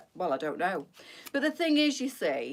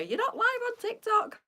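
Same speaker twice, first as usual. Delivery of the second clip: much higher put-on voice, teasing; Benita. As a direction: squeak it out like a friend correcting her. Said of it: "Benita on at me".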